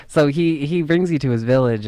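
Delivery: usual speaking voice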